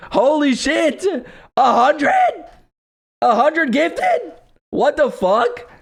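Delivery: high-pitched disbelieving shouts; stunned, yelling out in shock. Real, not acted. Shocked by a hundred gifted subs.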